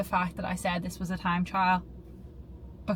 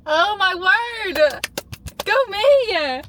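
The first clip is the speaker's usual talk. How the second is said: high, loud, slow joyful cry; thrilled cheer after a personal best; elated cheer; 10 semitones higher, 6 dB louder, much slower than usual. Real, not acted. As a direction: cheer for yourself with wonder. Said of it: "I'm so emotional".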